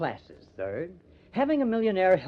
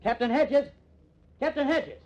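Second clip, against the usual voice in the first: higher and louder call, rising in alarm; calling out urgently; 9 semitones higher, 4 dB louder than usual. Acted. Reporter calling to the captain who lies collapsed, alarmed and loud. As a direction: call his name to rouse him.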